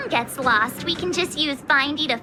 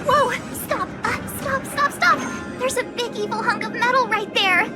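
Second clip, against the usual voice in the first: alarmed warning; 5 semitones higher than usual. Acted. Urgent warning about enemy robot ahead.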